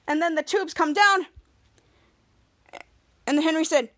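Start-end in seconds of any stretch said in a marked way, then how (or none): none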